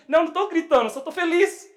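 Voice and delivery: high, loud, excited denial; happy shouting. Acted. Preacher denying to her that he is shouting, happy yell. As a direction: yell the protest with joyful excitement. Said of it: "Por que você tá gritando comigo?".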